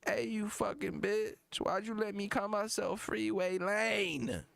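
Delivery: high, slow mock anger; hammed-up aggrieved rapper impression. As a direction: drag out the complaint in a high put-on voice. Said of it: "He still got mad at her".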